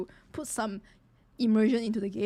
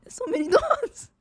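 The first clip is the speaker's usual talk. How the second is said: high squeaky words through laughter; giggling helplessly; real laughing squeak; 13 semitones higher, 6 dB louder than usual. Real, not acted. Laughing at scene.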